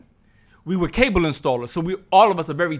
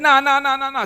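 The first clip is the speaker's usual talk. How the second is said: louder, higher scornful brush-off; hostile dismissal; 8 semitones higher, 5 dB louder than usual. Retold or imitated. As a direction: wave it away with contempt. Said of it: "I saw the hostility already in his voice".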